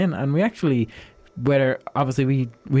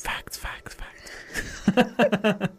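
whispered "facts" fading like an echo; 7 semitones higher, 6 dB quieter, much slower than usual. Pretend.

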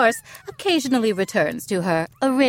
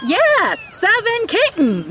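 much higher, louder crow of joy; triumphant exclaim; 13 semitones higher, 4 dB louder than usual. Acted. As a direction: proclaim it gleefully. Announcing seventh kitten.

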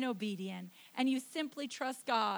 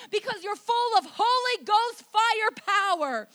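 loud, high, driving sermon delivery; impassioned revival preacher, raised pitch; preaching at full force; 8 semitones higher, 10 dB louder than usual. Real, not acted. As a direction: fire up the congregation at full volume.

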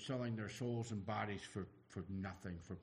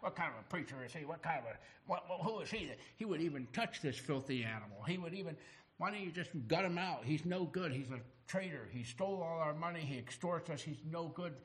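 higher-pitched, acted outrage tumbling out; indignant grumbling; 6 semitones higher than usual. Crowd grumbles about Jesus visiting a tax collector, indignant. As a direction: pile up complaints like a scandalised crowd. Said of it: "He grumbled".

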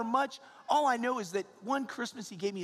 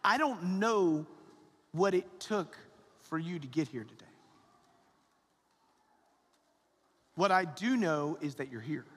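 gentle and slow; 5 semitones lower, slower than usual. Acted. Slow reflective sermon lines.

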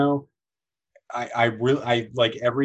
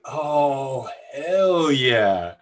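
drawn-out, relishing exclamation, louder at the end; really long; 4 dB louder, much slower than usual. Retold or imitated. Kim throws her head back and agrees, really long. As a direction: imitate a long gleeful yes. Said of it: "does this really long".

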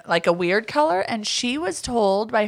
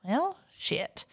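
near-whispered, deflated aside; retold sheepish realization; 6 semitones higher, 11 dB quieter than usual. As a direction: say it under the breath as the client. Retold client realizes her own mistake.

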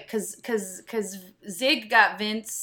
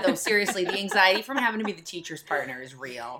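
normal talking voice

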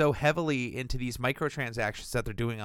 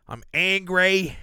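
mock angry; 6 semitones higher, 6 dB louder, slower than usual. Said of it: "No, I'm not angry".

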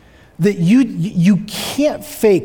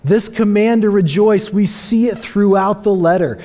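ordinary talk, nothing marked

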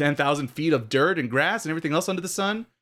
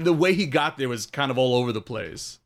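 usual speaking voice

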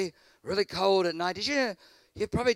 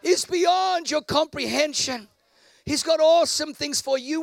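preaching at full force; 9 semitones higher, 6 dB louder than usual. Acted. Preacher shouting to the congregation, full force.

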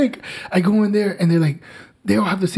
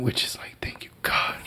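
breathy whispered thanks under the breath; hushed thank-you whisper; whispered relief; 10 dB quieter than usual. Real, not acted. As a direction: whisper it like a grateful secret. Relieved aside, baby finally asleep.

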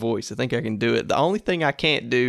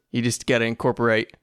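ordinary talk

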